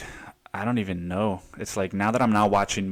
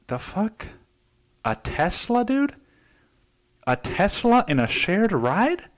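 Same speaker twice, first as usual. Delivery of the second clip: excited disbelief; 9 semitones higher than usual. Retold or imitated. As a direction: squeal out amazement at a Tesla. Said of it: "I'm getting all excited about this".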